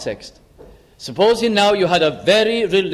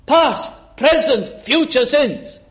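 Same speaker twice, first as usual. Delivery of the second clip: joyful; 7 semitones higher, slower than usual. Retold or imitated. Convert exults over forgiveness.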